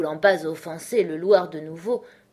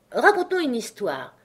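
higher-pitched command; ordering; 6 semitones higher, 4 dB louder than usual. A narrator's reading. March Hare demanding a story, ordering. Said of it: "ordonna le lièvre de Mars".

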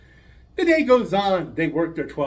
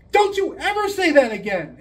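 stern warning; 9 semitones higher, 4 dB louder than usual. Acted. Teacher scolds Jimmy.

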